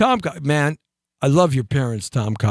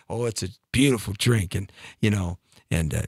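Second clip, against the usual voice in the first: low, soft crooning salesman; smooth pickup line; 9 semitones lower, 3 dB quieter than usual. Retold or imitated. The guy at the bar pitches the drink, low and soft.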